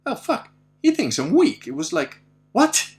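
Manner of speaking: high, loud, incredulous challenge; retold indignation. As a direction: pull rank on a lion, mock-outraged. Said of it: "that was the kind of feeling that I had".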